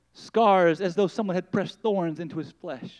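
normal talking voice

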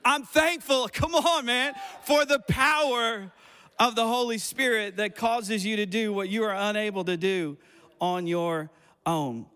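loud, high, driving sermon delivery; full-force preaching. Real, not acted. Pastor rousing the room for the Holy Spirit, full-force preaching.

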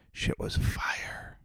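slow breathless whisper; slow, awed whisper about the food. Acted. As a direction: hush it like a secret.

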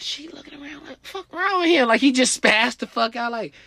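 high, incredulous voice; baffled girl. Retold or imitated. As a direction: play her utterly confused by him. Girl's baffled reaction.

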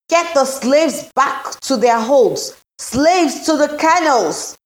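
high, loud, slow barked orders; frantic overseer shouting; panicked commands. A narrator's reading. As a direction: bellow orders over a sinking ship. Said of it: "panicked commands".

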